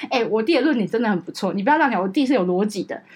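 ordinary talk

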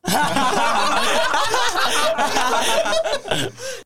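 long shrieking laugh, high and loud; long high laughter. Real, not acted. Host shrieks with laughter at the guests' answer, high laugh.

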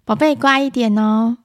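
put-on gently coaxing a child; patient mother. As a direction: act a mum kindly asking for good behaviour. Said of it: "聪明的妈妈会这样说". (聪明妈妈温和哄劝)

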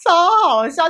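high, loud laughing through the words; can barely get the words out for laughing; real helpless laughter; laughing hard. Real, not acted.

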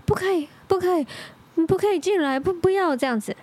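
high pleading sleep talk. Retold or imitated. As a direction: beg someone off in your sleep. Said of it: "然后他就一直说".

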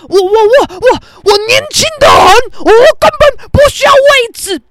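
high, loud mock protest; blustering old man. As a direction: imitate a stammering old man insisting at full pitch. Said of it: "前面这个黄灯阿伯还没说". (高声夸张模仿阿伯结巴逞强)

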